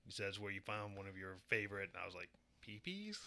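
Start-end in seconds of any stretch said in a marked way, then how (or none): 2.6-3.2 high incredulous question; mock disbelief, voice jumping up; squeaky mock surprise at P Ps. Mocking quote of friend.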